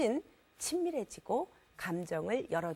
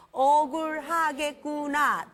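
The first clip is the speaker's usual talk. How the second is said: very slow, louder model line; slow empathic demo; 4 semitones higher, 6 dB louder, much slower than usual. Shown as a demonstration. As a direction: lead the audience through it slowly. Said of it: "시작".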